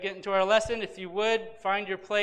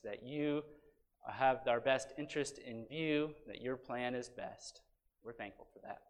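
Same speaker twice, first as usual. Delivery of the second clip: soft, low, earnest prayer; lowered voice, steady and reverent; public prayer; 6 semitones lower, 6 dB quieter than usual. Real, not acted. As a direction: lead the congregation in a hushed, thankful prayer. Leads congregation in prayer.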